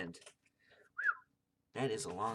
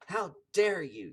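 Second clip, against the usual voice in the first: loud, slow, high outrage; indignant, drawn-out outburst; angry shout; 6 semitones higher, 10 dB louder, slower than usual. A narrator's reading. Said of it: "she shouted".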